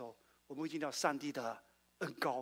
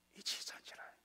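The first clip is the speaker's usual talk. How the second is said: a dramatic hushed whisper; 13 dB quieter than usual. Acted. Preacher drops to a whisper on the climax of the story. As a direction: breathe it in a hush.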